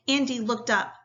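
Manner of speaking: usual speaking voice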